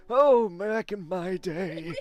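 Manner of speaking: high, drawn-out raspy elder voice; raspy old person. Acted. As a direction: rasp it out slowly like a creaky old-timer. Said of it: "the raspy old man or old lady".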